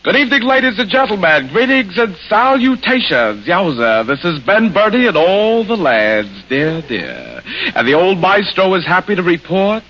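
stuffed-up bandleader's showy patter. Shown as a demonstration. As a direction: greet the crowd like an old maestro with a head cold. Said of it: "catch a cold and drop his French accent, he might sound like this".

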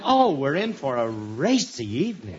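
sarcastic, high mock delight. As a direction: fake cheer dripping with sarcasm. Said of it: "Don't be sarcastic".

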